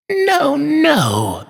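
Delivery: high, soothing toy maker; comforting. A narrator's reading. Toy maker comforts tearful girl, soothing. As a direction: reassure her in a lifted, tender voice.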